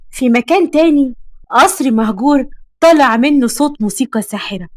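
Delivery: ordinary delivery, nothing marked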